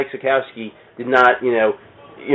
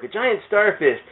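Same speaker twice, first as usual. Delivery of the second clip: mock eureka; 6 semitones higher, much slower than usual. Comic writer on vacation finds his idea, hammed-up excitement. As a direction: pitch jumps up, mock excited discovery. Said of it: "and was like".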